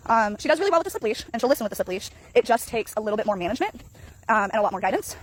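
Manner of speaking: usual speaking voice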